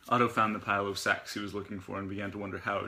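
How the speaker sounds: ordinary delivery, nothing marked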